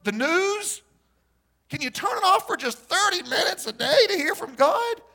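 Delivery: high, straining, near-crying plea; preacher pleading with the crowd, high and strained. Real, not acted. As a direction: beg them to switch off the news.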